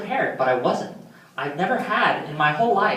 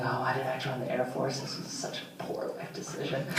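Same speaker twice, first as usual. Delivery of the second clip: muttered, breathy inner thought; inner grumble; 6 semitones lower, 16 dB quieter than usual. Retold or imitated. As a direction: sigh the regret to yourself.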